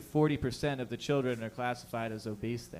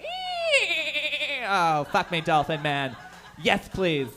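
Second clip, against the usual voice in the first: mock swooning lady; 4 semitones higher, 3 dB louder, much slower than usual. Mock woman swooning over dolphin man.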